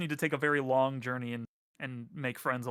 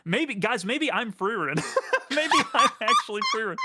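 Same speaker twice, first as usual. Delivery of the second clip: giggling through it, higher; laughing; 8 semitones higher, 6 dB louder than usual. Real, not acted. Joking about being Freerin, laughing. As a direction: laugh while teasing yourself.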